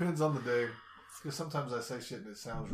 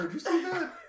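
high mock impression; 5 dB louder than usual.

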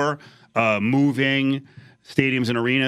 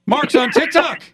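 high-pitched, thrilled announcement; excited reveal; 13 semitones higher, 4 dB louder than usual. Real, not acted. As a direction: burst out with the big news. Host excitedly reveals Mark's on TikTok.

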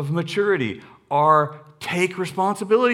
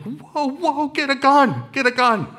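mock alarm; 9 semitones higher, 5 dB louder than usual. Panicked bystander at dog.

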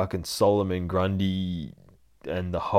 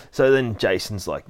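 ordinary delivery

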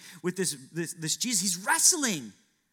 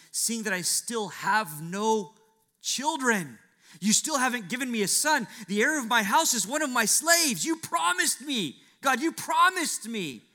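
higher, louder, anguished plea to God; pleading complaint; 8 semitones higher, 7 dB louder than usual. Acted. Abraham pleads with God over his missing son, anguished and louder. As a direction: cry out that God broke his word.

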